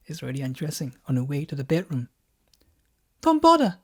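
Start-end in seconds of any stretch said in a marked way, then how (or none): 3.2-3.8 high, loud, teasing wife; a high cheeky wife confessing; wife's voice. Acted. Wife reveals she's on pill.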